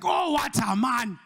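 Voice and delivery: high, slow, admiring voice with a cry of delight; swooning Nigerian girl. Retold or imitated. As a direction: gush over a foreign-sounding man. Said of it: "the Nigerian girl is like".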